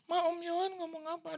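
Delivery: high excited question to his wife; imitating the pastor. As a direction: ask high and eager. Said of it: "dia ngomong sama istrinya".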